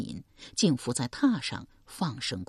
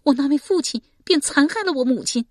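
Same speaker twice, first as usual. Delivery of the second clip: put-on higher, louder young woman's bitter line; 10 semitones higher, 6 dB louder than usual. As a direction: voice her grief as a young woman. Shi Pei's bitter line.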